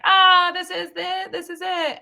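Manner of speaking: high, loud, slow triumph; elated. Retold or imitated. Finally programming a station, high loud and elated. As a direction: savor the big moment. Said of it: "I was like".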